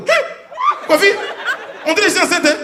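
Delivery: shrill high startled cry; a mother jumping in shock; mock shocked mother's yelp; startled mom. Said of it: "Ta maman, elle est comme ça".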